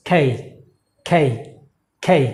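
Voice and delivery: খেই with a puffed k and gliding vowel; correct pronunciation. Shown as a demonstration. Teacher modelling the correct letter name, aspirated k. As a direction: breathe out on k, glide through খেই.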